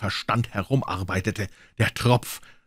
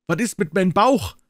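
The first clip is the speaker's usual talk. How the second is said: much higher, louder whine; howling boys; 11 semitones higher, 4 dB louder than usual. Acted. Boys wailing about their stomachs, high and loud. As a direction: wail like a hungry boy. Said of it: "heulten all die Buben".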